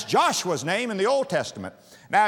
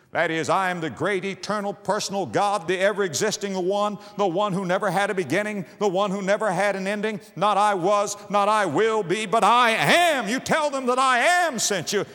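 rising, louder preaching that peaks on the last words; 4 semitones higher, 4 dB louder than usual. Acted. Preacher proclaiming God's eternal name, building preaching. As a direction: build it up to a shout.

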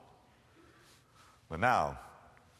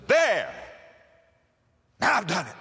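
louder, higher sharp exclamation; angry outburst; 4 semitones higher, 4 dB louder than usual. Acted. Farmer drops the burden, angry shout. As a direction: throw the words down with the load.

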